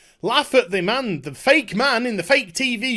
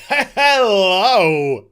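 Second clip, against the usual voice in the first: mock leering hello; 6 dB louder, much slower than usual. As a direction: greet her like a lech eyeing her up. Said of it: "he's just like".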